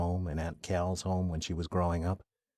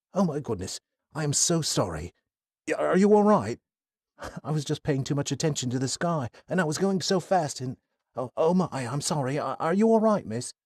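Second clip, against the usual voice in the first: put-on higher, fretful apologetic young man; flustered young man; 7 semitones higher, 3 dB louder than usual. Kai apologizing to Athena after nearly hitting her, flustered. Narrator voices flustered cyclist.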